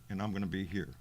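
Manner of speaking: usual speaking voice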